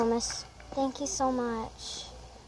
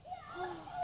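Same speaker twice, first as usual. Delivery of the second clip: gasp; 12 dB quieter than usual. Pretend. Pretend star excited at recognition.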